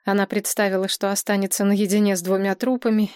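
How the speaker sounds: ordinary delivery, nothing marked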